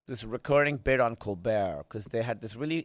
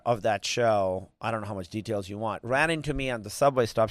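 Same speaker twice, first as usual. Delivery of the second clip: ordinary talk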